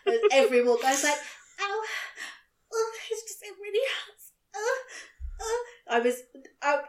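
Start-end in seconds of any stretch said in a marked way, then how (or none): 1.6-6.0 high, whimpering ow it really hurts, played up for laughs; mock whimper of a self-pitying patient; whiny pained moan. To her dad at the hospital, a high hammed-up whimper. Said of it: "I was really being pathetic".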